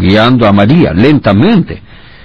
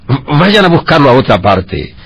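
gruff innkeeper; 6 semitones higher than usual. A narrator's reading. Innkeeper turning them away.

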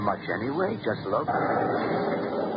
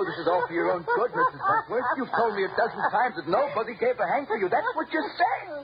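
much higher, giggling mock comfort; maniacal laughing taunt; 14 semitones higher than usual. Gardener taunting his crying victim, giggling madly. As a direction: laugh at her while pretending kindness.

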